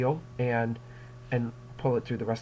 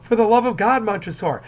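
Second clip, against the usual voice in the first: higher, louder begging; desperate plea; 7 semitones higher, 7 dB louder than usual. Retold or imitated. Teacher voicing Fortunato begging, higher and louder. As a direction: beg for your life. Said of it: "You know".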